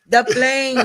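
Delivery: high, loud, put-on little-man voice; catchphrase impression. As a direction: shout it like a TV sidekick.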